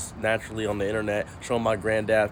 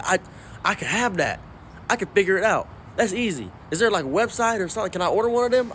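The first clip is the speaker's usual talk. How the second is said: eager granddad; 6 semitones higher than usual. Retold or imitated. Granddad eagerly asks to order.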